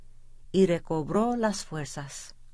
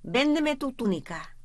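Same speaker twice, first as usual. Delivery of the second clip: raised, higher demanding call; shouting; 7 semitones higher than usual. A narrator's reading. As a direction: shout the order, sharply pitched up. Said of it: "gritó el jefe".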